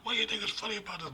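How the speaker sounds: high, fast mock girlfriend voice, half-whispered; offended girlfriend. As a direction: squeak it out like an indignant girlfriend. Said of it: "And goes".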